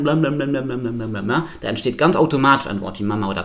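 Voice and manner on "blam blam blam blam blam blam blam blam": "blam, blam" run together in one even chain; imitated baby babble. Teacher showing how babies start to babble, repetitive babble. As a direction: babble "blam" over and over without a break. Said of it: "Babys, die anfangen zu lallen".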